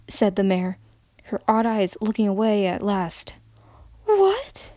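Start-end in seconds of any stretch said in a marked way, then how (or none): 4.0-4.7 a high, breaking cry of disbelief; voice cracking. A narrator's reading. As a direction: let the voice crack in shock. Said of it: "Applejack's voice cracked under the strain".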